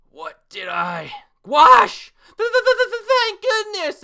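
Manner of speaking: very high, loud stuttering yell of relief; stammering shout. Acted. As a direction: squeal it out, tripping over thank. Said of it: "and Gouache is now getting up".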